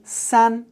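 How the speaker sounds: "san" held high and level; first tone. Shown as a demonstration. Teacher showing learners number three, high first tone. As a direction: keep "san" up on one even high pitch. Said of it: "That's a first tone again".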